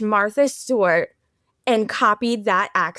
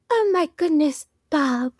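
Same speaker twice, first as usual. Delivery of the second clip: soft pouty diva; 4 semitones higher than usual. Retold or imitated. Imitating Meryl Streep's character needing bed, in a soft pout. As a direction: draw it out with dainty, precious poutiness. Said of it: "the soft poutiness of it".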